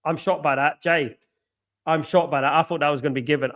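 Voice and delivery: ordinary talk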